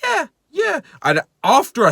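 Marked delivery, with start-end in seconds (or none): 0.0-0.8 high sing-song yeahs; chirpy customer-service yes; overly bright yeah yeah yeah; fake cheerful. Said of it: "at first I'm like".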